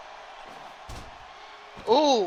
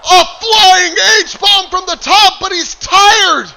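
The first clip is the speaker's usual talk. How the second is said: loud, high, drawn-out play-by-play yell; excited shout; 9 semitones higher, 10 dB louder, slower than usual. Real, not acted. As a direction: scream the big move call. Live wrestling commentary climax.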